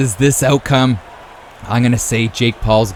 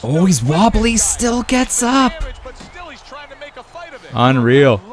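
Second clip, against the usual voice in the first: amazed fight call; 10 semitones higher than usual. Real, not acted. Commentator sees the wobbly fighter get up, amazed. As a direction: pitch climbing high in astonishment.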